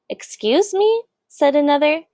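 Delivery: a mouse who thinks he misheard; disbelief. A narrator's reading. Council mouse can't believe it.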